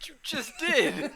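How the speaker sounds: high, loud, laughing accusation; laughing gotcha shout. Real, not acted. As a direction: nail him, laughing. Gotcha exclamation.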